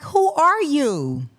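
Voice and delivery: loud, slow, indignant demand; emphatic outburst. Real, not acted. Ranting at the trial witness, loud and slow. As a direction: lean on every word in outrage.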